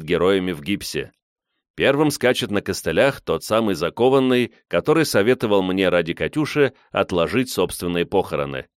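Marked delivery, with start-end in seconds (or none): none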